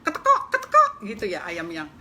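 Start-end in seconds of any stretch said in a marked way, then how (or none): 0.1-0.9 high loud startled clucks; hen clucking. Acted. Startled brooding hen clucking at the mouse, loud and high. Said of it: "ayamnya itu metekok".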